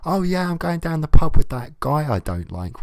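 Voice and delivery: mock a cheery pub plan; a hint of sarcastic chirpiness; mock cheerful. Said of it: "I couldn't imagine".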